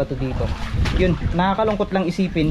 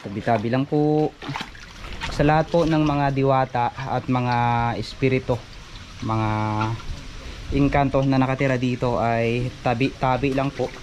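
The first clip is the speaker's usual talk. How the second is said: respectful prayer; 4 semitones lower than usual. Acted. Asks spirits for permission.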